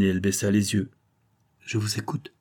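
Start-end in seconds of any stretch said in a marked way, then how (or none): 1.6-2.3 soft, yielding murmur; softly voiced under the breath. A narrator's reading. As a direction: murmur it quietly, giving in. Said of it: "murmura-t-elle".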